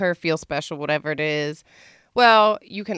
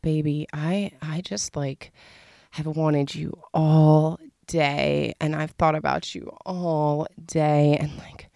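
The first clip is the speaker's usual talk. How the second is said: soft, slow mock pleading; hushed, drawn-out needy plea; begging seductively; 2 semitones lower, 4 dB quieter, slower than usual. Shown as a demonstration. Demonstrating begging a lover in bed, slow soft pleading. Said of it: "or you can beg them".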